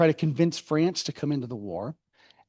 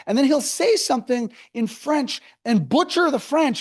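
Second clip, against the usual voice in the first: normal talking voice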